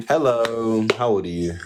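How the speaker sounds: ordinary delivery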